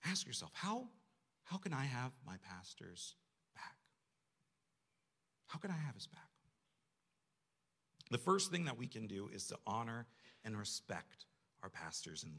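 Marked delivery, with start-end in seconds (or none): none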